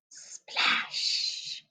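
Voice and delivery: hushed whisper; whispered splash. Acted. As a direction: breathe it out in a whisper. Suspenseful story read to children.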